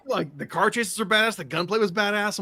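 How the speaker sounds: high-pitched enthusiastic raving; retold excitement. Fan defending the movie to his buddies, high and enthusiastic.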